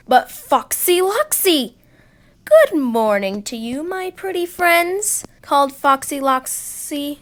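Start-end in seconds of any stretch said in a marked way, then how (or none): 2.4-5.2 sly fox. Acted. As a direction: lure them in sweetly. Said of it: "smiling slyly".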